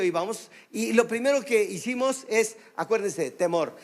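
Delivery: usual speaking voice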